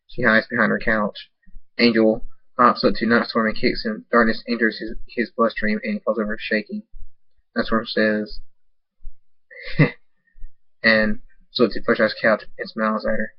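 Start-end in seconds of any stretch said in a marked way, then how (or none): none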